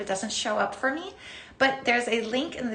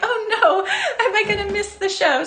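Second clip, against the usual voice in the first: panicked; 9 semitones higher, 6 dB louder than usual. Retold or imitated. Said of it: "I'm like".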